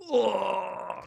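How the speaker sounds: high groan of effort; strained groan. Real, not acted. Streamer pulls out a stiff battery, straining groan. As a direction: groan while straining at something stuck.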